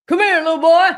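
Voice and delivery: high, loud leering call; creepy mock predator. Mocking the Booty Warrior luring a boy, high and loud. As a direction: leer at him like a creep. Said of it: "No teeth having ass, just".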